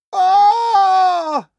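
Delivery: very high, loud squeal of fright; piercing cartoon pig squeal; terrified squeal. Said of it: "gave a terrified squeal".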